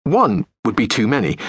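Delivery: usual speaking voice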